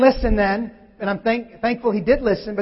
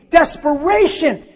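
ordinary talk

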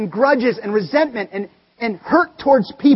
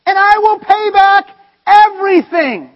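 high, loud, very slow pleading cry; begging servant; 9 semitones higher, 10 dB louder than usual. Acted. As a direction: plead desperately, dragging every word high and loud. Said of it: "he begged".

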